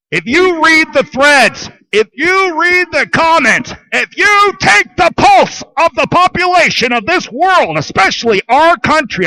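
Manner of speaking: an escalating, urgent rant voice; rising shout. Real, not acted. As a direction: ramp it up line by line. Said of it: "building rant".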